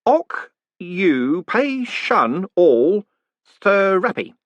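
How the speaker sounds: oc you pay shun all therapy sounded out slowly, syllable by syllable; careful hard word. Acted. Warder struggling with a long word for the prisoner, sounding it out slowly. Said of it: "his forehead wrinkled".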